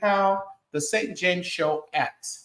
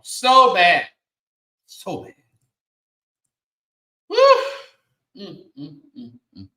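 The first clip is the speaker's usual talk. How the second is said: ordinary talk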